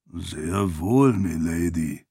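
low, puzzled servant's assent; confused. Acted. Servant accepts milady's odd order, low and confused. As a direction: agree uncertainly, voice dropped low. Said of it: "mit verwirrt klingender Stimme".

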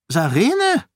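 high, loud call across the room. A narrator's reading. Queen calling Sarene across the room, high call. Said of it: "rief Eschens Stimme".

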